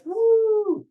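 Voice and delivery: high, loud party woo; a wild party yell; party whoop. Retold or imitated. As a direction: whoop like a kid living it up.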